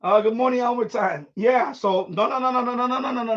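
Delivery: ordinary talk, nothing marked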